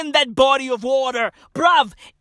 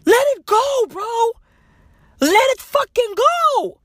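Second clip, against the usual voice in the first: high yell; 11 semitones higher than usual. Acted. Comic ranter screaming let it go. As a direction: scream it at the crowd. Comedic rant, yelling advice.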